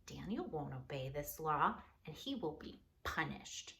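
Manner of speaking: scheming plotters. Acted. Helpers scheming against Daniel in low, soft voices. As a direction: plot it low and sly.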